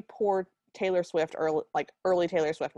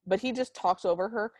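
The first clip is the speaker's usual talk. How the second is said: ordinary talk, nothing marked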